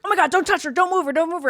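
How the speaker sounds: high, loud alarmed cries; urgent warning not to move her; panicked bystanders. Retold or imitated. Crowd at the resort after a fall, panicking loudly.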